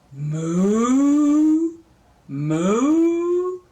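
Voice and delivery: loud moo sliding up high; long rising cow imitation. Grandma showing how a cow goes, mooing loudly. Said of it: "A cow goes".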